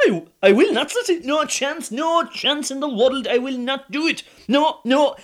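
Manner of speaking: high, loud laughing refusal; husband loudly refusing the pope plan; flat refusal. Acted. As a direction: refuse outright, half laughing.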